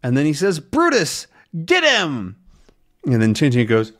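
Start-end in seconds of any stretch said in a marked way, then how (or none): 0.6-2.4 high-pitched order to a dog; urgent attack command. Acted. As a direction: set the dog on the enemy. Villain sets dog on Tintin.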